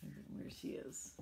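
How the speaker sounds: hushed whisper. Real, not acted. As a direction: point her out in a whisper. Pointing to picture.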